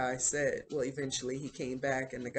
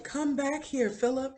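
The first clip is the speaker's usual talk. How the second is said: much higher, mildly raised calling voice; retold yell; 11 semitones higher, 3 dB louder than usual. Schoolmaster calling after Philip rowing away, retold yell. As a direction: imitate someone yelling after a runaway. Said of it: "He's yelling at him".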